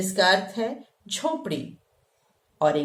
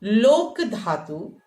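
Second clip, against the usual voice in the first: slow demonstration; 3 dB louder, much slower than usual. Shown as a demonstration. Teacher drawing out a Sanskrit term for students, slowly. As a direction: spell out लोक धातु slowly, word by word. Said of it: "जिसे कहते हैं लोक".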